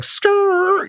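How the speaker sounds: "skrrr" squeaked very high like a record scratch; comic vocal scratch sound. Retold or imitated. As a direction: mimic a record stopping dead. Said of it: "the record stops".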